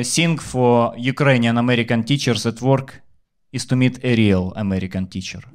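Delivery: ordinary talk, nothing marked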